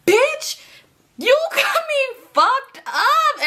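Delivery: high, loud, drawn-out mock outrage; outraged shout. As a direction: holler in disbelief, stretching the words. Acted outraged reply to friend's claim.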